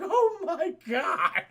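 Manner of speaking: high, drawn-out mock wail; hammed-up slow cry of horror; mock horrified wail. Leia watching her planet explode, mock wail.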